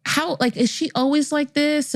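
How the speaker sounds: hesitant, mildly bewildered doctor; puzzled doctor. Retold or imitated. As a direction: grope for a tactful way to ask.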